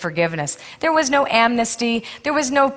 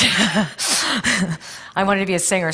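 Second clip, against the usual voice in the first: laughing; much slower than usual. Real, not acted. Laughing admission of old dream.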